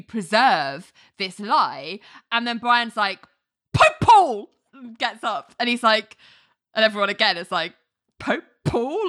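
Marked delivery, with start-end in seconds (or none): 3.7-4.5 high, loud barked objection; spring up and shout the objection; mock courtroom shout. 8.2-9.1 high puzzled question, a bit higher; mild confused echo; baffled echo. Retold or imitated. Everyone baffled by the objection.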